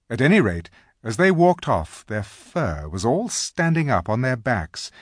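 usual speaking voice

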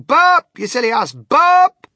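full-force, high-pitched shout. A narrator's reading. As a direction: yell it up at someone floating away. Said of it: "I stood there shouting".

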